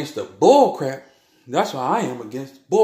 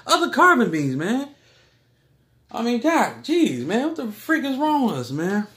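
exasperated; 9 semitones higher than usual. Real, not acted. Exasperated at people fighting.

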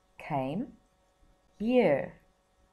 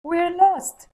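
put-on high, loud, hurried boy's voice; boy quickly telling the men they are lost; quick child's reply; 13 semitones higher, 11 dB louder than usual. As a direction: blurt it out like a scared little boy. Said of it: "said Chip quickly".

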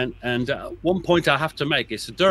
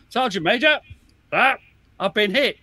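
laconic; 8 semitones higher, 4 dB louder than usual. Retold or imitated. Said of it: "was a bit laconic himself".

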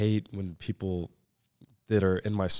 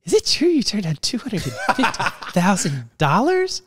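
much higher, louder, laughing coach voice; coach's disbelief; 12 semitones higher, 8 dB louder than usual. Retold or imitated. Coach asking his player about turning down the money, high laughing disbelief. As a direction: squeal it in amazed disbelief through a laugh. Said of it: "Coach Maneri, never forget it".

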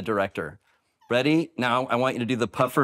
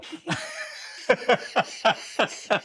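high laugh; 12 semitones higher than usual. Real, not acted. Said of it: "Oh my gosh".

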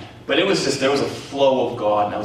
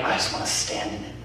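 whispered wish; 8 dB quieter than usual. Retold or imitated. Quietly resisting falling under power.

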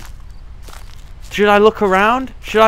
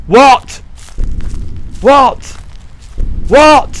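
alarmed what; 6 semitones higher, 5 dB louder, much slower than usual. Real, not acted. Repeated alarmed questions.

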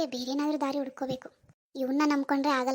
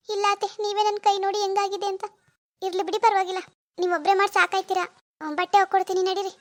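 put-on martyred pleading, pitched up; acting pitiful; 5 semitones higher, 3 dB louder than usual. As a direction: pretend to offer help despite the pain. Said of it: "ಹೆಂಗಿದೆ ನನ್ನ acting".

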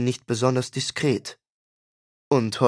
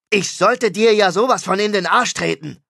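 higher, louder furious threat; angry threat; 7 semitones higher, 5 dB louder than usual. Acted. Rock star threatening his brother, angry. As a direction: threaten him through your teeth. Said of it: "Mosch war ziemlich sauer".

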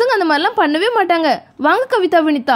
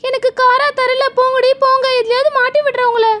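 put-on high-pitched child's voice, put out; a small girl plotting revenge; sulky little girl; 7 semitones higher than usual. (கோபித்த சின்னப் பொண்ணு குரல்)

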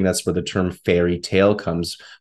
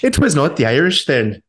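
higher-pitched mock Irish lilt; bad Irish accent; 7 semitones higher than usual. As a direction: repeat it in a playful fake Irish accent. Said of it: "a lovely bad accent".

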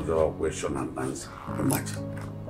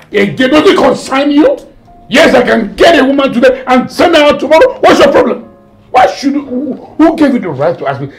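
high, very loud angry tirade; indignant, pitched-up shouting; enraged father; 14 semitones higher, 15 dB louder than usual. Acted. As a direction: roar at your children for questioning you. Father rages at questioning children.

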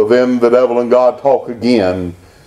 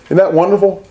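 usual speaking voice

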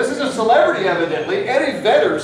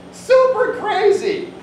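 slow, much higher exclamation; high exclaim; 13 semitones higher, 3 dB louder, slower than usual. Acted. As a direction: stretch it out high in disbelief.

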